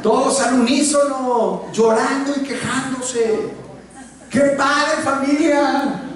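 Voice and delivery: high, slow, sarcastic exclamation; mock the whiny family; sarcastic mockery. Real, not acted. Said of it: "sarcasmo sobre la familia". (sarcasmo agudo y lento)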